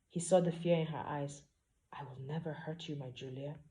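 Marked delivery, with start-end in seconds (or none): 1.9-3.6 soft reassurance. Acted. Hero reassures frightened heroine.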